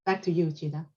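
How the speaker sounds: ordinary talk, nothing marked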